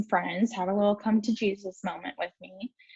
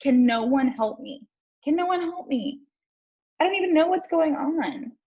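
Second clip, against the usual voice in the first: rising higher, desperate exasperation; telling mom on the phone, pitched-up frustrated pleas; upset and pleading; 7 semitones higher than usual. Retold or imitated. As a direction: vent to mom in frustrated despair. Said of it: "I had this same energy. I was so pissed".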